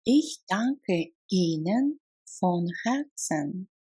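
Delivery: normal talking voice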